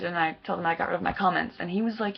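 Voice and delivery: ordinary talk, nothing marked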